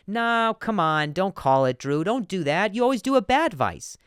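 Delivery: high, loud excited banter; mock protest. The host mock-arguing with his audience, high and loud. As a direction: argue with yourself, hammed up.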